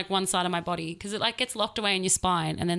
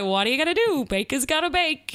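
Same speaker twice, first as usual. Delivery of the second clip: manic baker who never stops; a touch of crazed shrug; mad scientist baker; 6 semitones higher than usual. Pretend. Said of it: "Or it's like".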